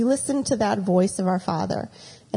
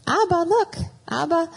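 put-on kids calling; 9 semitones higher than usual. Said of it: "they'd call out".

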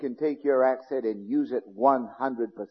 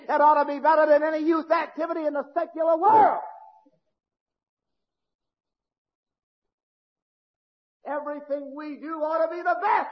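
fiery preaching; 11 semitones higher than usual. Real, not acted. Preacher rallies congregation.

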